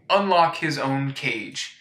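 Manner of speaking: usual speaking voice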